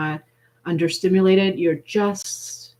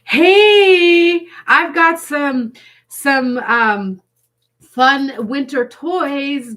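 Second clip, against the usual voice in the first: much higher, louder teasing dare; playful friend; 6 semitones higher, 5 dB louder than usual. Pretend. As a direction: tease like a friend daring you. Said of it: "The same friend is reaching out".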